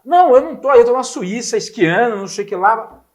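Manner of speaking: a hint of a careless friend on holiday; mock carefree Diego. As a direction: imitate the friend shrugging it off. Diego's voice, retold phone call.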